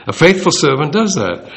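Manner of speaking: ordinary talk, nothing marked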